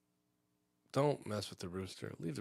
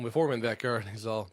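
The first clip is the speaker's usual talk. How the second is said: ordinary talk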